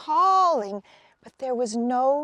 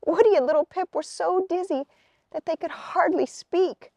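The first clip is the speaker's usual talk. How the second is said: ordinary delivery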